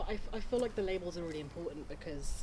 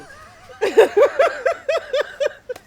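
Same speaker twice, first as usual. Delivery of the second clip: loud laugh; 16 dB louder than usual. Real, not acted. Big laugh.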